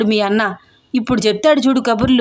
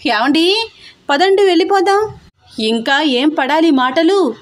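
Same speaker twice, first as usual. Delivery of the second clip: high wailing cry to her husband, slowing at the end; 7 semitones higher than usual. A narrator's reading. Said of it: "అంటూ ఏడుపులు పెడుతూ".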